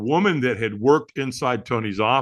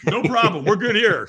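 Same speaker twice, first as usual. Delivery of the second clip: much higher and louder, gushing agreement; eager yes; 10 semitones higher, 4 dB louder than usual. Retold or imitated. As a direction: snap up the offer with delight. Acting eager to take the deal.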